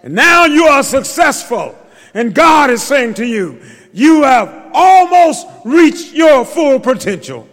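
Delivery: loud, high preacher's shout to the climax; fervent climactic preaching with music; preaching at full force. Acted. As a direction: lift the church to its feet.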